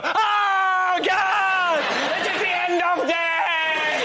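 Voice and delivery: hammed-up high wail of doom, drawn out; panicking English. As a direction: wail it slowly like the world is ending. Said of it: "English people go".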